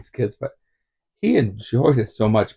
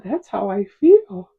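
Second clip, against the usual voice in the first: put-on high kid voice, getting louder; kid's threat; 9 dB louder than usual. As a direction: voice the boy calmly promising to kill. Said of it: "he said".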